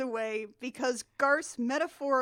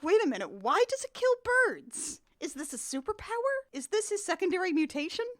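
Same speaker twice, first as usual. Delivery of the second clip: puzzled; 6 semitones higher than usual. Retold or imitated. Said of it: "I was like".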